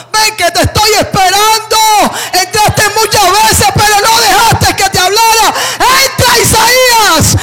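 loud high shouted call, slowing on the end; God calling out. Acted. God calling Isaiah into the temple, loud fervent call. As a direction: cry out the invitation like a voice from above. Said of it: "Dios le decía así".

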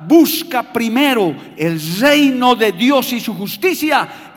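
loud, slow preaching at full force; predicación a pleno pulmón. Acted. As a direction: proclaim it to the whole church, slow and loud. Preaching climax on scripture. (predicando a voz en cuello, lento y fuerte)

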